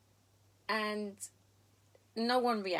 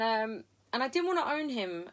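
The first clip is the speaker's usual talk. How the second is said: ordinary delivery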